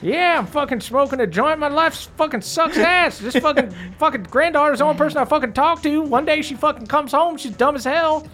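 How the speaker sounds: put-on high, fast old granny tirade; swearing granny rant. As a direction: rant like a bitter grandma. GM plays granny NPC.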